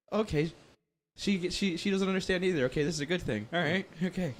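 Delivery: high, flustered self-reassuring okays; semi-mortified. Retold or imitated. Mother mortified by the coworker's boast, flustered and reassuring herself. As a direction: talk yourself calm while mortified. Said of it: "semi-mortified".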